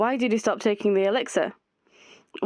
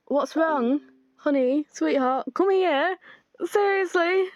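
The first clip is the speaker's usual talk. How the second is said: higher, sugary coaxing; coaxing sweet voice; 5 semitones higher, slower than usual. Acted. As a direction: lure them in with honey. Cooing, coaxing character voice.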